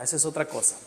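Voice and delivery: ordinary talk, nothing marked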